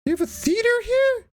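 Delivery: mock-amazed high squeal; surprised. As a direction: act shocked at a town theater.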